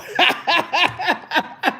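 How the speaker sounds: high-pitched burst of laughter. Real, not acted. Laughing at his son's teasing, high laugh. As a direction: let a high laugh break out.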